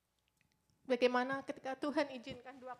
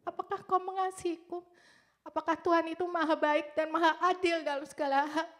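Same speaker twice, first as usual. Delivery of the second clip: raised, pleading questions to God; louder as the questions build; anguished questioning; 4 semitones higher, 6 dB louder than usual. Retold or imitated. Teenage cancer patient questioning God, anguished and pleading.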